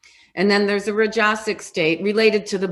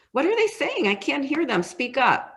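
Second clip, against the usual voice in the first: higher, mock-annoyed inner complaint; frustrated straining student; 6 semitones higher than usual. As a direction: plead for the teacher to speak up. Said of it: "straining to hear".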